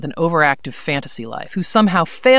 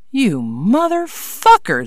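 slow, higher, seething curse; angry; 7 semitones higher, slower than usual. A narrator's reading. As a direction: spit the curse out slowly. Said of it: "Zara said".

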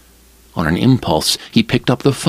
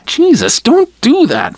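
high startled gasp, louder; 5 dB louder than usual. A narrator's reading. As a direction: blurt it out in sudden alarm, pitched way up. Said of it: "Farrell gasped".

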